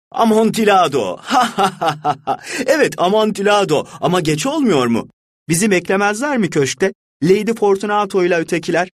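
high, cracked laughter of a walled-in man; pitiful laughing voice. A narrator's reading. Fortunato laughing from inside the wall, high and pitiful. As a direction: laugh it out high, half mad. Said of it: "Fortunato'nun sesine hiç benzemiyordu".

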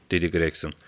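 usual speaking voice

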